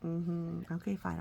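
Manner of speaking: plain reading voice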